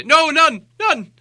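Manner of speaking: high, stammering frightened hobbit; terrified stammer. Acted. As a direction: stutter out in panic, shaking all over. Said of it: "he said immediately afterwards".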